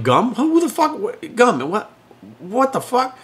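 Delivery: high, rising cries of disbelief over the gum; incredulous disbelief. Retold or imitated. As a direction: squeak out stunned outrage. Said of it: "I'm like".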